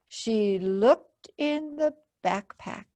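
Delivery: ordinary delivery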